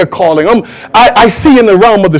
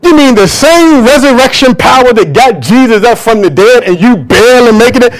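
normal talking voice

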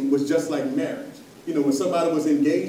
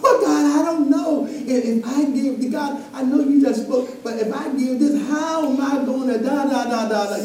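higher, laughing mock of a worried believer; doubting believer; 8 semitones higher than usual. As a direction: play the hesitant giver, half-laughing. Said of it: "y'all know what I'm talking about".

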